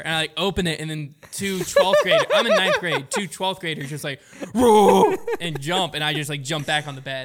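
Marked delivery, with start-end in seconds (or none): 4.5-5.2 loud mock bear roar. Seniors scaring a freshman, mock bear roar. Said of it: "roared like a bear".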